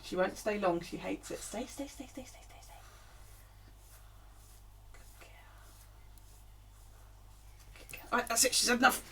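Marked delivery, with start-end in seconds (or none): 1.4-2.7 soft, fading repeated command, ending almost whispered; soothing dog command. Real, not acted. Coaxing the dog to stay, softly fading. 4.9-8.1 whispered praise. Real, not acted. Praising the dog in a whisper.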